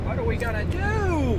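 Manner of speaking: high-pitched melodramatic wail of despair; mock panic. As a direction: play up the stranded panic. Mock-dramatic stranded in desert.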